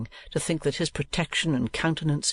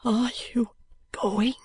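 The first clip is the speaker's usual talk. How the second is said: earnest whisper; 9 semitones higher, slower than usual. A narrator's reading. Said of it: "in an earnest whisper".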